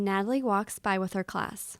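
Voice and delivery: ordinary delivery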